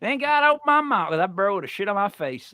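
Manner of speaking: thick mock Southern twang; imitated Southern accent. Host quoting father-in-law's Southern friend, mock Southern accent. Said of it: "I heard this Southern guy".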